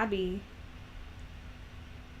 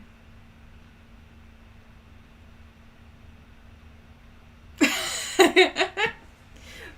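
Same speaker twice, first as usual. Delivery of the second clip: burst of laughter; 9 semitones higher than usual. Real, not acted. Cracking up at own character attempt, high burst of laughter. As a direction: silence breaking into high laughter.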